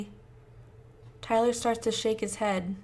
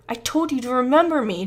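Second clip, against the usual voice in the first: louder, angry growl; 4 semitones higher, 8 dB louder than usual. Acted. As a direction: growl it with anger. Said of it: "Josh growls".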